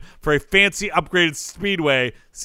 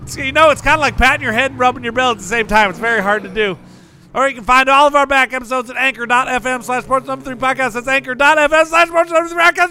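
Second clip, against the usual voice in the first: [name]; 6 semitones higher than usual. Pretend. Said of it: "Oh, hey, Taylor".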